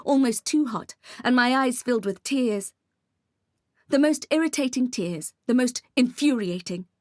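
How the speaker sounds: ordinary delivery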